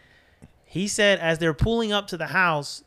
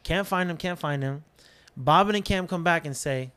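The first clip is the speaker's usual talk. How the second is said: ordinary delivery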